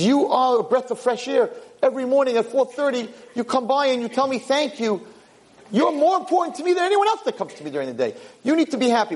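high, impassioned praise; gushing. Acted. King thanking the little boy, gushing. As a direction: pour out appreciation, voice climbing high.

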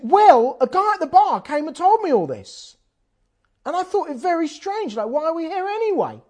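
high, loud wife voice; voicing wife. Wife explaining herself in the car park, high and loud. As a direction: play the indignant wife. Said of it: "she said".

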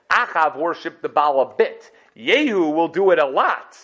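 loud, drawn-out mock announcement; put-on sarcasm, building on the second half; sarcastic proclamation. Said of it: "He said sarcastically".

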